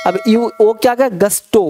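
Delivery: ordinary talk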